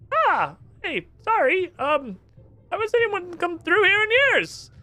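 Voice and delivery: chirpy surprised underwater stranger; squeaky NPC. Acted. Trapped islander greets the first visitor, high squeaky.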